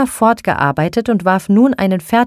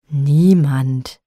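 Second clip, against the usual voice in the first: growled; 3 semitones lower than usual. A narrator's reading. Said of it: "knurrte er wieder".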